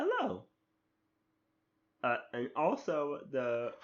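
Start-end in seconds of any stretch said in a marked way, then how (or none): 0.0-0.4 very high, annoying little voice; little doll boy. Acted. Guessing at Yamcha's companion, high-pitched voice. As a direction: squeak a greeting in falsetto. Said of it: "that's probably his voice".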